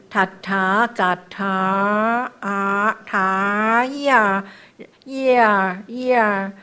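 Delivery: very slow 塔 stretched and gliding into 呀; turning sound. Shown as a demonstration. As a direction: hold 塔 and 呀 long, turning the sound between them. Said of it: "音就转了".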